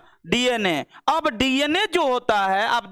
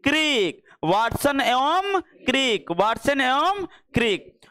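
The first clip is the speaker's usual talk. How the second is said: slow dictation; much slower than usual. Shown as a demonstration. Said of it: "ये DNA का design किसने दिया था?".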